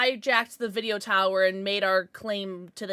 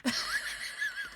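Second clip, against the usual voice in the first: high squealing laugh; 7 dB quieter than usual. Real, not acted. Bursting into laughter.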